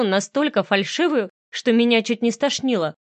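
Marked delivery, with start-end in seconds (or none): none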